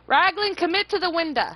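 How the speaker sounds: high, loud shout to a teammate; urgent callout. Real, not acted. Ordering teammate Raglan through the window, urgent shout. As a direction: yell the order across the game.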